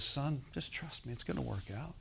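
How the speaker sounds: soft, hushed reassurance; quiet fatherly comfort; inward voice. Acted. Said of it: "It's just an inward, an inward voice".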